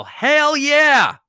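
high, loud sports-crowd yell; big drawn-out rallying shout; yelled cheer. Acted. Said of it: "and yell".

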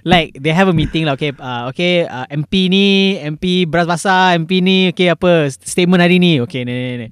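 ordinary talk

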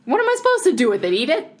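high, fast mock outrage. Real, not acted. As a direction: sputter it out in disbelief. Mock indignant question.

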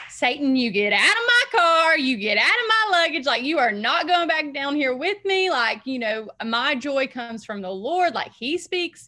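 loud, high, fired-up shouting rebuke; rebuking Satan. Retold or imitated. Driving past the exit, she shouts Satan out of her car. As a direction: command the devil to leave, full force. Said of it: "I was just going at it".

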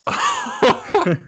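high laughing squeak through the words; can barely get it out for laughing. Real, not acted. Laughing hard while telling friends the story.

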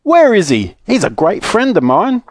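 high, eager questioning; excited cry. Acted. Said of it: "he cried".

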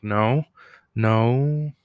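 loud, drawn-out mock no; retold loud refusal. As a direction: act out a firm, loud refusal.

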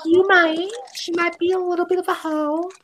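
squeaky high sassy tone, up top; high teasing gossip voice. Pretend. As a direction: play it like juicy gossip.

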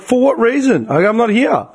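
high-pitched, incredulous retort; exasperated. Retold or imitated. Customer arguing over a parcel being sent back, exasperated. Retold exasperated argument with call centre.

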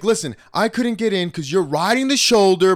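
high, loud retold excuse; defensive protest. Voicing the other driver arguing back, defensive.

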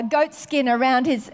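ordinary delivery

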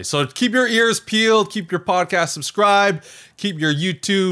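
hammed-up podcast plug; promo announcer. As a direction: sell the next episode like a radio promo. Hosts' announcement of next episode.

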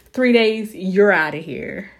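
loud and triumphant; bold declaration. Acted. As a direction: shout it out like chasing off the sickness. Declaring sickness gone in three days.

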